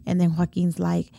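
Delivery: ordinary delivery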